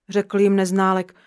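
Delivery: ordinary delivery, nothing marked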